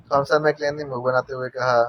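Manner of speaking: plain reading voice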